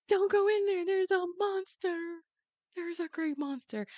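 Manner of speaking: fleeing sister warning the others, high and frightened; mock panic. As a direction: cry out like a terrified girl. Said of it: "comes freaking out of the palace doors".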